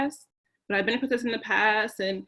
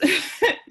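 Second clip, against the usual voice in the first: nervous laugh; 10 semitones higher, 6 dB louder than usual. Real, not acted. Apologizing after emotional outburst.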